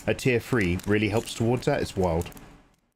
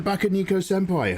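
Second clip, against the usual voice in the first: excited name call; 8 semitones higher than usual. Real, not acted. Reading subscriber name excitedly.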